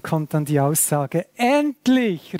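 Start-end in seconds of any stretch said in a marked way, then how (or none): 1.3-2.4 high-pitched cry of "at last"; raised, exclaimed scripture quote; exclaiming. Acted. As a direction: call it out as a long-awaited exclamation. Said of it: "rief Adam aus".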